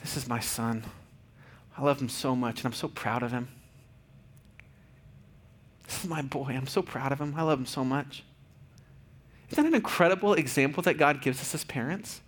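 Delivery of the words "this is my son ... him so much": soft, intimate retelling of a father's pride; almost hushed, full of love; tender paraphrase. Retold or imitated. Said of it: "Hear what he's saying?".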